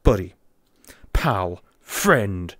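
low, loud, separated words; mock-threatening, each word falling; mock confrontational. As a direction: square up to someone, one low word at a time.

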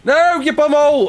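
high, loud cry of protest; real yell of frustration at the game; outburst. Real, not acted. Gamer cursing at the game mid-stream, high loud outburst.

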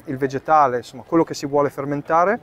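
ordinary delivery

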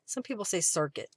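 normal talking voice

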